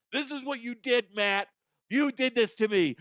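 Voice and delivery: hammed-up scolding; mock accusing wife. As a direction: blame Matt in a put-on wife voice.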